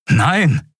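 high, vehement denial; heftiger Ausruf. A narrator's reading. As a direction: cry it out in protest. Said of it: "rief er aus, mit derselben Heftigkeit". (heftig ausgerufen)

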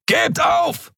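high, drawn-out yell; thundering shout. A narrator's reading. Scarred man demands surrender from far end, thundering shout. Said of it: "donnerte ein narbengesichtiger Mensch".

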